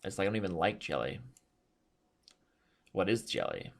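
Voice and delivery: ordinary talk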